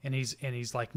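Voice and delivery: normal talking voice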